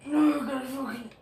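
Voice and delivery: hiccuping mid-sentence; words broken by a hiccup. Real, not acted. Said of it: "sorry, I got a hiccup".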